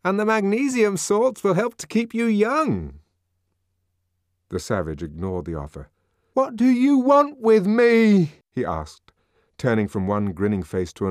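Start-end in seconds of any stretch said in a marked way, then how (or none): 0.0-3.0 high, coaxing sales pitch; nervously placating. A narrator's reading. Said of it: "with a rather nervous smile of propitiation". 6.3-8.4 high, loud, drawn-out cry; anguished demand. A narrator's reading. Cornered savage demands of the mob.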